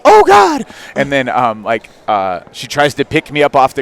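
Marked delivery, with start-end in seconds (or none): 0.0-0.6 shrill high startled yelp of a lady; a bystander's sudden loud gasp of alarm; shocked cry. Retold or imitated. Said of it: "I hear a lady go".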